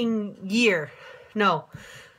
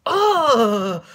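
disgusted ugh; 6 dB louder than usual. Real, not acted. Disgusted outburst after the rant.

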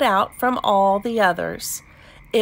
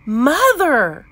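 loud, high storybook cry; shout; 4 semitones higher, 6 dB louder than usual. A narrator's reading. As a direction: call out loudly for mother. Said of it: "the little chicken shout".

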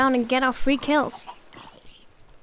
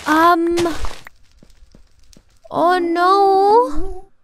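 alarmed; 6 semitones higher, 6 dB louder, much slower than usual. Real, not acted. Realizes it's night.

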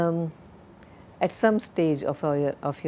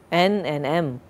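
ordinary talk

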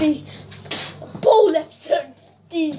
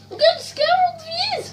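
spooky high voice; 14 semitones higher than usual. Pretend. Announcing scare in high voice.

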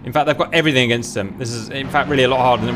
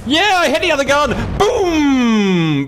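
high excited whoop; triumphant cheer; 12 semitones higher, much slower than usual. Real, not acted. Destroys a gun, celebrates.

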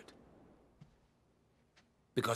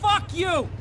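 high, loud furious yell; angry shout; 13 semitones higher, 7 dB louder than usual. Acted. Biker yelling at the Russian thug, shouting. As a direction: hurl the insult at him.

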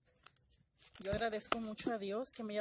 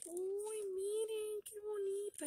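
much higher, thrilled squeal; delighted squeal; 10 semitones higher, slower than usual. Real, not acted. Unboxing her friend's gift, she squeals with joy.

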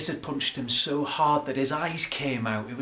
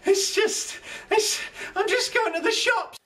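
very high, nervous voice; squeaky frightened excuse; panicked squeak; 13 semitones higher than usual. Acted.

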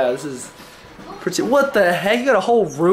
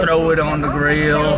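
Texan accent; 5 semitones lower than usual. Shown as a demonstration. Showing a friend what a Texan sounds like, Texan accent. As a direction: put on a low cowboy Texan accent. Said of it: "That's my Texan accent".

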